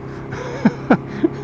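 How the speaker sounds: quick high breathy laugh; short real laugh. Real, not acted. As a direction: laugh at your own question. Laughs at own question.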